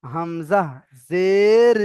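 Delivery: long, swelling "জের", short "ই"; spelling demonstration. Shown as a demonstration. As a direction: recite slowly, stretching "জের" in an arch. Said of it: "দেখেন".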